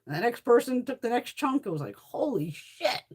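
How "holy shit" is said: slow, drawn-out amazed swear; awed disbelief. Retold or imitated. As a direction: let it sink in slowly, stunned. Retold amazement at machete chunks.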